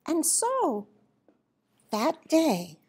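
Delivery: usual speaking voice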